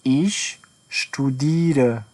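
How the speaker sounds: a drawn-out teaching pace; very slow model phrase. Shown as a demonstration. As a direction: stretch out every syllable of studiere.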